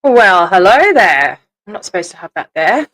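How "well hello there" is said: loud, slow, drawn-out greeting; drawn-out hello. Real, not acted. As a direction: stretch the hello out loud and playful. Streamer greets viewers on starting live.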